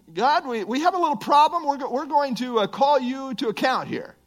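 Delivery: high-pitched, hesitant mock complaint; hammed-up awkward challenge, pitched high; mock confrontation. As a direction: timidly pretend to take the boss to task. Mock confrontation of God by humans.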